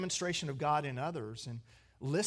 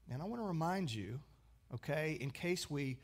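ordinary talk, nothing marked

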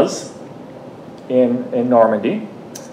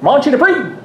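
high Newfoundland mangling of French, "Monchy Dupree" as "MON-chee duh-PREE"; Newfoundland accent; 12 semitones higher than usual. Retold or imitated. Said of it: "I can't do a Newfoundland accent".

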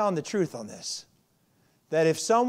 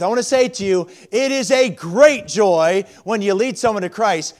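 preaching at full force; 3 semitones higher, 8 dB louder than usual. Acted. Preaching sermon.

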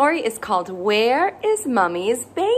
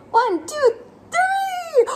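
building count; slower than usual. Acted. Host counting with toddlers before the reveal, pitch climbing. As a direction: count climbing higher to a very high three.

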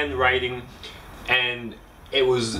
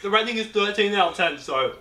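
normal talking voice

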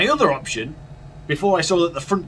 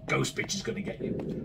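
whispered threat; 8 dB quieter than usual. Real, not acted. Game voice answering him.

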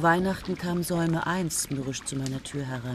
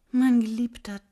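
loud call to beloved; 6 semitones higher, 8 dB louder than usual. Acted. Sudden outburst to her beloved.

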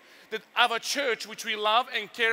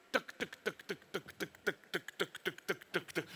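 soft clacking train sound, tuk tuk; hushed mechanical tuk tuk; train clacking noise; 3 semitones lower, 10 dB quieter than usual. Retold or imitated. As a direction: mimic the coaster chain quietly clicking. Imitating the train climbing.

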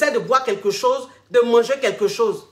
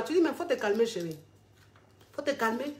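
much softer, soothing; calming him down; 10 dB quieter than usual. Acted. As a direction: settle him down gently, darling. Wife calms husband. (la femme calme son mari, tout doucement)

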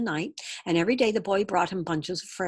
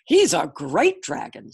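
loud, slow proud proclamation; rejoicing, drawn-out praise; jubilant father; 8 dB louder, slower than usual. A narrator's reading. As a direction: declare it with big emphasis. Father rejoices, tossing his hat.

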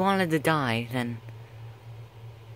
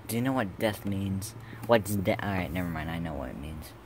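ordinary delivery

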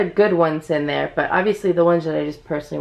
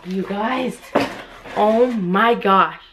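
ordinary delivery

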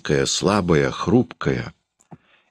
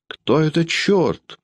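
much higher, slow indignant question; hot-tempered cry; 12 semitones higher, slower than usual. Acted. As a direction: fire it back, offended. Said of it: "запальчиво крикнула Людмила".

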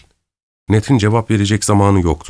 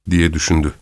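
usual speaking voice

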